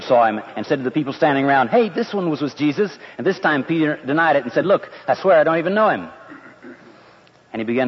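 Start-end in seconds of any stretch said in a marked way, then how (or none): 1.7-3.0 calling out accusation. Acted. Maid calls out to bystanders. 4.7-6.1 insistent denial. Acted. Peter denies the accusation.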